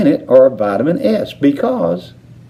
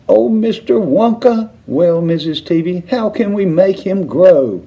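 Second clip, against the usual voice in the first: wailing; 8 semitones higher than usual. A narrator's reading.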